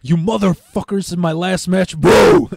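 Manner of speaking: builds to a loud high boo; mock yell at the fire. Cursing the fire over the last match, ending in a loud shout.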